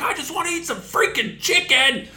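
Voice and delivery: high, loud yelling rant with a laugh; top-of-lungs rant. Retold or imitated. Worker screaming at general manager over chicken, loud and high. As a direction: yell it at the top of your lungs. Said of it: "cussing him out at the top of my lungs".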